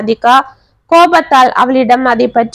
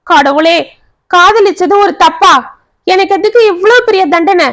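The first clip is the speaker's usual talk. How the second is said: high, anguished lament without sobs; narrated mild wail, voice pitched up; 6 semitones higher than usual. A narrator's reading. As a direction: cry out to God in despair. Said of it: "ஓவென கதறினாள்".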